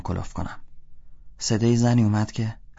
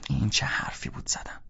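unvoiced whisper to himself; 4 semitones higher, 9 dB quieter than usual. A narrator's reading. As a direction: breathe it out, hushed. Narrator scolding himself.

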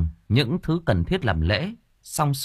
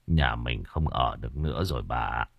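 put-on much lower, softer, defeated old man; frightened husband; 11 semitones lower, 7 dB quieter than usual. Husband tells wife they cannot stay home, low and beaten. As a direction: resign yourself, quietly.

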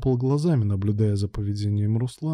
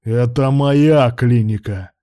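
loud, slow angry assertion; barked; 7 dB louder, much slower than usual. A narrator's reading. Boss asserts the clinic is his, barking. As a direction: bark it, stretching the words. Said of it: "рявкнул Руслан".

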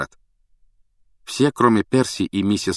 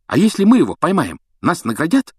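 high, louder eager question; excited, pitched-up asking; fired up; 10 semitones higher, 6 dB louder than usual. A narrator's reading. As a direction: get carried away by the idea. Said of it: "загорелся Рон".

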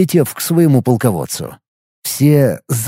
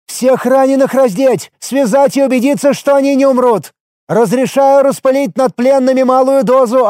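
commanding general; 11 semitones higher than usual. A narrator's reading. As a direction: give orders like a bird general. General barks orders to troops.

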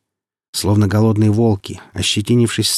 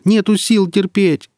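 high, slow cry of pain; pleading cry; 11 semitones higher, 4 dB louder, slower than usual. A narrator's reading. Merchant being rubbed with snow, crying out in pain. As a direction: wail it as if unable to bear more. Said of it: "кричит Марек".

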